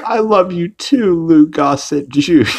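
hammed-up lovey reply; imitating Peter. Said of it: "And he's like".

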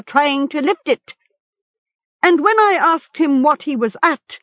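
high-pitched, jeering account; accusing chieftain. A narrator's reading. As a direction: expose the captive before the gathering.

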